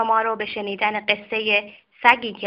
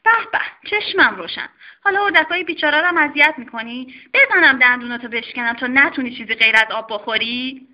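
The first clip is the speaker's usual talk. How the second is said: wag a finger at the naughty dog; scolding doctor; 4 dB louder, faster than usual. Acted. Doctor scolding the dog for bullying kittens, mock-stern. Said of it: "دستشو توی دهن آقا سگه کرد".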